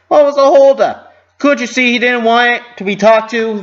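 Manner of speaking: loud, high scolding; angry exclaim. A narrator's reading. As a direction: scold a friend sharply and loud. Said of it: "Flores exclaimed".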